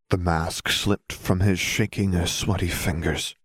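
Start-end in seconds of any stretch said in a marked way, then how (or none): none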